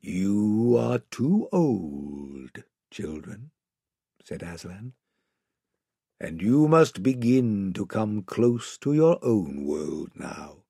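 very slow, low, hushed lion voice; Aslan, gentle and solemn. Acted. Lion sending children back to their own world, gentle and solemn. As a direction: tell them softly and gravely, taking your time.

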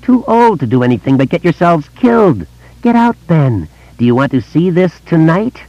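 high, stretched-out monster taunt; taunting vampire clown. A narrator's reading. Monster goading Ben about being too old, high taunting voice. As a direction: jeer in a high, mocking creature voice.

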